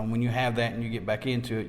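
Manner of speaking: normal talking voice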